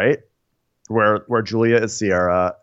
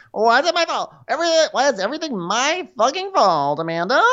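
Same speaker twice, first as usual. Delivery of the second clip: high-pitched put-on protest; mock whiny outburst. Blamed friend protesting to Amanda, mock outburst. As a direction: whine it high, hamming up the grievance.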